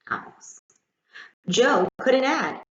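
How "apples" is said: whisper. A narrator's reading. Whispered final word, loving apples.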